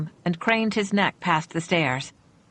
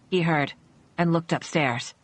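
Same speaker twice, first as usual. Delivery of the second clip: ordinary delivery, nothing marked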